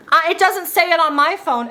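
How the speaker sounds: loud passenger. Retold or imitated. Passenger arguing with others about her phone, mock loud. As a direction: put on a loud, insistent woman. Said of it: "she was being so loud".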